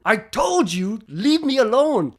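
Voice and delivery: insistent retold telling-off; mock exasperation. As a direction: imitate her fed-up outburst. Imitates her escalating protest.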